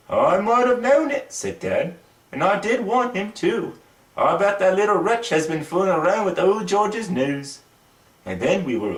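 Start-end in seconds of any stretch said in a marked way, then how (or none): 0.1-7.6 the dad sighs over his son's mischief; exasperated father. Acted.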